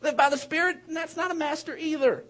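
normal talking voice

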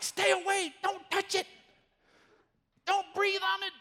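high, urgent mock warning; hammed-up fussy baker, pitched high; protective baker. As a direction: keep everyone back from the cake. Baker guarding the cake.

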